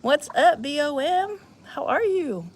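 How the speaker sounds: high, sing-song pet greeting; dog-talk voice. Acted. Greeting the dog playfully, high pet voice. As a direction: coo at the dog like a baby.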